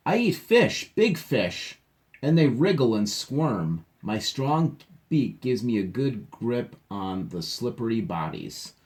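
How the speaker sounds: opens high and loud, a playful read-aloud; reading to children. A narrator's reading. Teacher reads the stork's reply to the kids, playful read-aloud. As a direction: read the stork's boast with a grin.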